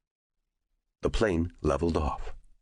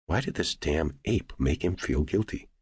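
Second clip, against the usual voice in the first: usual speaking voice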